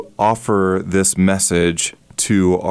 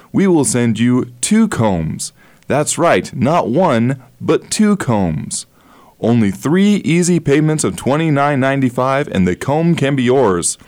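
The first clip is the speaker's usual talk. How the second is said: home shopping host; 3 semitones higher than usual. Shown as a demonstration. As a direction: push the deal like a TV salesman. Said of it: "using the infomercial style".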